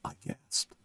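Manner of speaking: sharp breathy gasp of alarm. A narrator's reading. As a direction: catch your breath in fright. Narrator gasps at the leap.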